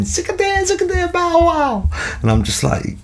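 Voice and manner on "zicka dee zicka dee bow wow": very high squeaky nonsense voice; silly talking fish. Pretend. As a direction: give the staring fish a daft, piercing little voice. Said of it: "there's a fish looking at me going".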